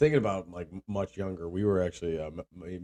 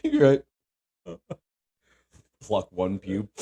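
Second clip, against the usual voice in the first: ordinary talk, nothing marked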